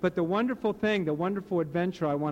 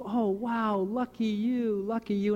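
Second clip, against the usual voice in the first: high, sing-song villagers gushing over his luck; envious villagers; 5 semitones higher than usual. Retold or imitated. Villagers congratulating the farmer on the wild horses, high and gushing. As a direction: gush like a whole village of neighbours. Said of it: "the whole village goes".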